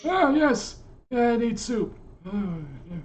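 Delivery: put-on high wheezy old man; a dotty old man wanting soup; breathless old person. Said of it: "that wheezy old man laugh".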